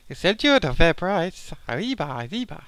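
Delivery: imitating a Mexican accent badly. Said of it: "I can't do Mexican accents".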